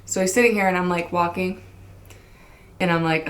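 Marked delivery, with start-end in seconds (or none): none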